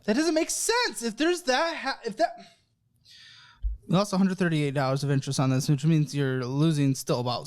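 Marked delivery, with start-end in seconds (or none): 0.0-2.3 high-pitched, baffled protest; exasperated bafflement. Real, not acted. Host can't make sense of guest's card use, exasperated.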